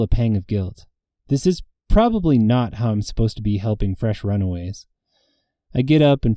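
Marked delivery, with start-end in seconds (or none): none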